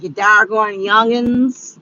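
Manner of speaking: put-on slow, scolding old granny voice. Vlogger quoting an old movie granny, slow and scolding. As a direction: wag a finger like a cranky granny. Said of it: "old granny was like".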